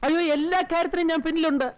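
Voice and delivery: high-pitched, eager reassurance; hastening to reassure; eager assurance. Acted. Servant eagerly assures the mistress.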